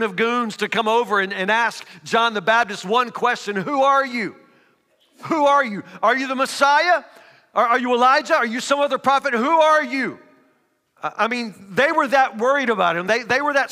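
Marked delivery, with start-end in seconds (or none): 3.6-10.2 demanding questioners. Acted. Pharisees grilling John.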